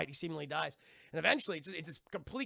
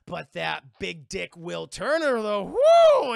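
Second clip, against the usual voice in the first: swooning whoop; 15 dB louder, much slower than usual. Retold or imitated. Maid swooning over Will Turner.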